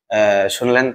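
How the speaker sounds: usual speaking voice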